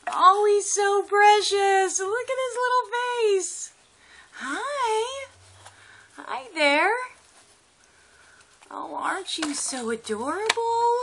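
high, loud, slow cooing. Real, not acted. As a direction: melt over a cute pet. Cooing at the lizard.

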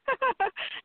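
high, bright-pitched laugh; cracking up at the story's end. Real, not acted. Laughing at her punchline.

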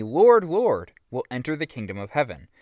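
ordinary delivery

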